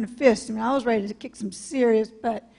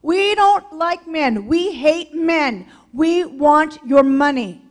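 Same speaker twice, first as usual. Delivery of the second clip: slow, louder, every word hammered; slow, heavy, louder declaration; slow emphatic; 3 semitones higher, 6 dB louder, slower than usual. Real, not acted. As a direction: lay it down blunt and deliberate. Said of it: "It's all about the money".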